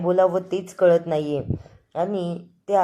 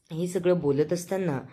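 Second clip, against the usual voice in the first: normal talking voice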